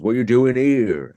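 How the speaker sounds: a hint of a grumpy old railway porter; station guard impression. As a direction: play a suspicious country station guard challenging strangers. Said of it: "this is a very good impression".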